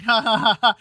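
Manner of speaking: ordinary talk, nothing marked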